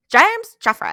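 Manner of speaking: put-on high, loud scolding in a hammy Scottish accent; exasperated Scottish mother's voice; mock Scottish accent. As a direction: call them out in mock Scottish. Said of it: "don't even attempt a Scottish accent".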